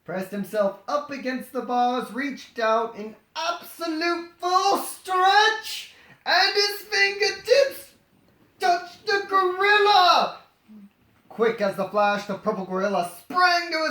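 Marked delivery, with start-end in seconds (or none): 2.1-10.4 slow, higher, drawn-out climax; storyteller milking the big moment; suspenseful build. A narrator's reading. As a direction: stretch each word as the fingers reach. Suspense peak of the reading.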